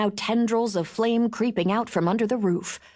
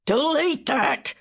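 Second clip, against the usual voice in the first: yelled; 6 semitones higher, slower than usual. Acted. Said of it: "Bill yelled".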